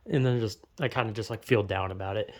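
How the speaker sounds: usual speaking voice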